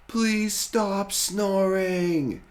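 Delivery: high, loud and slow yell. Acted. Friends yell at Rory to stop snoring, loud and high. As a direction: shout it up at the window. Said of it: "they all yell".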